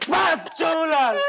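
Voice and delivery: high, drawn-out commanding shout; angry shout. Acted. Kidnapper halting the arguing over the captive, angry shout. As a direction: shout to cut the argument dead.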